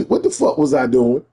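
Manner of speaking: normal talking voice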